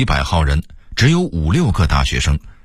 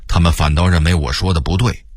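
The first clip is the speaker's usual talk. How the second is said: ordinary delivery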